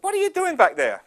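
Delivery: exasperated dad. Acted. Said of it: "So he said".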